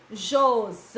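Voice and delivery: "José" called out loud, ZÉ held long; loud teacher's demo of "José", lingering on the last syllable; drawn-out stressed syllable. Shown as a demonstration. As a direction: stretch the final "sé" of "José". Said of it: "a tia demora mais pronunciar".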